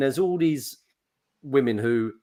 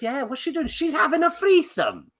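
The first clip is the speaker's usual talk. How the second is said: much higher mock Welsh voices; Welsh neighbours chatting; 12 semitones higher than usual. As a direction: trade it like village gossip. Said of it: "that's her dad or mum".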